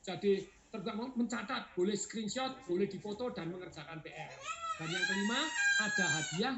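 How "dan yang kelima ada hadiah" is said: laughing squeal. Real, not acted. Excited announcement of prizes.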